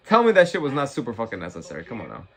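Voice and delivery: usual speaking voice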